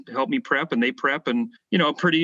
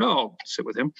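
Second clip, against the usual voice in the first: casual; 2 semitones lower, 3 dB quieter than usual. Retold or imitated. Telling how he picked his lunch seat, casually. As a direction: toss it off casually. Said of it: "So casually".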